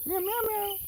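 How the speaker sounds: very high mock meows; meowing. Streamer meowing at her cat in a very high voice. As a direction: meow like a kitten.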